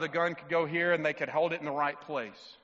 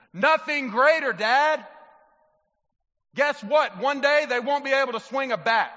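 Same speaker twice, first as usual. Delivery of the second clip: higher, louder, fervent pulpit preaching; preaching at full force; 7 semitones higher, 7 dB louder than usual. Acted. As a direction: drive it home to the fathers. Preaching climax to dads.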